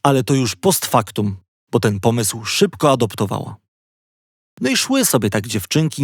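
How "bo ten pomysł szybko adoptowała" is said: plain reading voice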